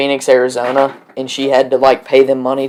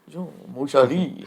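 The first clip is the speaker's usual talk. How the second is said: quiet put-on French accent; soft French accent; 11 dB quieter than usual. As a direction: murmur it like a film Frenchman. Joking French imitation.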